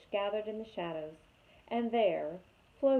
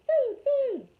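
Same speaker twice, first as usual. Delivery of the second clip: high, louder squeaky chick demanding food; hungry gosling; 9 dB louder than usual. A narrator's reading. Gosling voice held.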